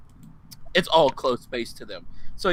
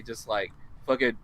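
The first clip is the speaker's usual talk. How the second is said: ordinary talk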